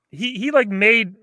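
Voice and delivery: talking while blowing nose; words muffled by a tissue. Real, not acted. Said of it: "I'm blowing my nose here".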